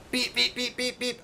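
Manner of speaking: high mock alarm beeps; high-pitched mock machine beeping; imitated beeping.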